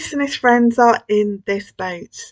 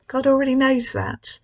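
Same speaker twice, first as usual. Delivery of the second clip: ordinary delivery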